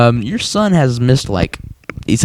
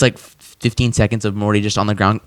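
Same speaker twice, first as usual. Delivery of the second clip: normal talking voice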